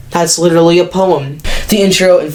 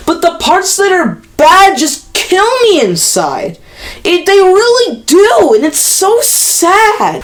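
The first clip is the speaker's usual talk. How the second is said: whiny; 11 semitones higher than usual. Real, not acted. Lamenting bad parts.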